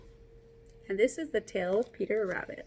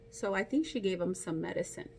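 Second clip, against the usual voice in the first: ordinary delivery, nothing marked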